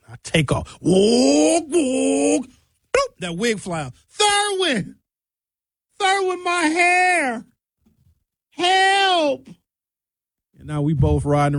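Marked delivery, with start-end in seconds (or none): 0.8-2.5 mock wind whoosh. Wig flying off in wind. 4.2-9.5 high, slow lisping cry for help; lisping panicked woman. Retold or imitated. Lisping woman shrieks at the driver about her lost wig. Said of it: "Why does she have a speech impediment?".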